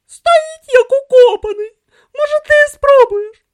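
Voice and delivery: very high, loud yelling; exasperated shout. A narrator's reading. Rider complains to companion that the camel won't budge, shouting. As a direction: yell it out in loud, high-pitched frustration.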